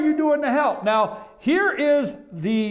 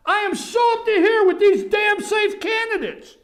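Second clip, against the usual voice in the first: worked-up outburst; 10 semitones higher than usual. Real, not acted. Radio host ranting at safe candidates, angry shout. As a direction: let it boil over at them. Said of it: "So let me calm down here".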